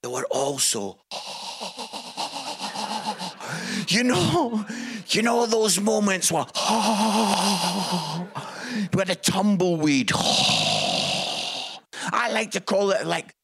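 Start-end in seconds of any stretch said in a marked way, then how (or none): 1.0-3.8 long shuddering breathy gasp; drawn-out shocked gasp. Acted. Audience's shocked silence acted out. 6.5-8.9 long breathy mock gasp; drawn-out shocked gasp. Acts out an awkward audience moment. 10.2-12.1 long airy rush of breath; tumbleweed whoosh. Acted. Sound of a tumbleweed moment.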